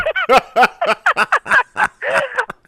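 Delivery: high-pitched laughing fit; high laughter. Real, not acted. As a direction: let the laugh spill out high. Laughing at the joke.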